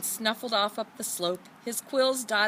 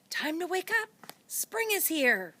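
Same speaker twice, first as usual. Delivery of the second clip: cheerful wake-up call; 9 semitones higher than usual. A narrator's reading. Earth wakes the earthworm.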